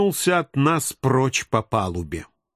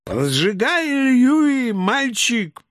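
put-on high drunken urging shout; drunken yelling; 9 semitones higher, much slower than usual. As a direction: howl encouragement, drunk and wild. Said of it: "старательно орал". (пьяный крик с подначкой)

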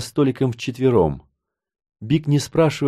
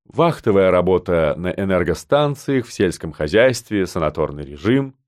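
low, composed listing; unperturbed; 6 semitones lower than usual. A narrator's reading. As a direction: list the items unruffled, pitched low. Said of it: "невозмутимо продолжил Пер". (невозмутимо перечисляя, низким голосом)

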